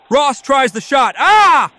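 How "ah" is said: sharp loud groan at the save; frustrated loud cry. Real, not acted. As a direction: yell as the shot is stopped. Shot blocked.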